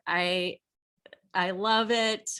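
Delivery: normal talking voice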